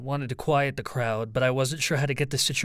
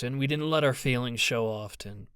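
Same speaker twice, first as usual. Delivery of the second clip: ordinary delivery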